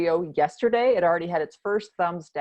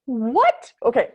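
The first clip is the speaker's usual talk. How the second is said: ordinary talk, nothing marked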